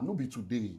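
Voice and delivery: normal talking voice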